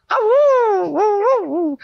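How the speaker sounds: high, long, loud howl; eerie high-pitched "awooo"; wolf howl. Acted. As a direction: howl like a wolf in a horror serial. Howl in horror radio drama demo.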